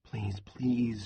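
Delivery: hushed desperate inner plea; silent imploring. A narrator's reading. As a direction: beg him silently, under your breath. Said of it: "she implored in her mind".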